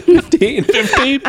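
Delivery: high laughing repeat. Real, not acted. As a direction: laugh through the word fifteen. Laughs at the joke.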